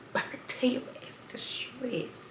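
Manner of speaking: hushed, slow whisper to the audience; soft secretive whisper; whispered aside. Real, not acted. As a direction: let them in on a secret. Aside to viewers.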